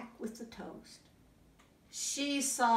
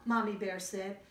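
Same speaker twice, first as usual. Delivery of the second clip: usual speaking voice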